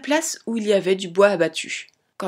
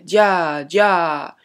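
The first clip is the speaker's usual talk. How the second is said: louder, drawn-out carter's cry; calling to a horse; 3 semitones lower, 5 dB louder than usual. A narrator's reading. Little Tom drives the cart past strangers, loud carter's call. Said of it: "le petit Tom criait".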